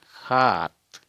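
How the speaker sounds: loud हाथ, opening on kh-h, as khaat; Assamese kh pronunciation. Shown as a demonstration. Teacher giving an example of the kh sound, emphatic khaat. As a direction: push the throaty kh in हाथ. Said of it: "ख का या ह का उच्चारण".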